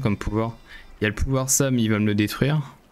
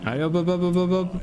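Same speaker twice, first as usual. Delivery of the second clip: higher chant of hop hop; rally the troops with a quick call; urging on; 6 semitones higher than usual. Real, not acted. Urging units forward.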